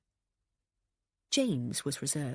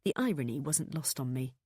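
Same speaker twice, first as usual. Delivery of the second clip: usual speaking voice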